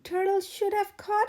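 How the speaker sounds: high, loud grumble; grumbling. A narrator's reading. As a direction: grumble the blame along. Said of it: "grumbled Iguana".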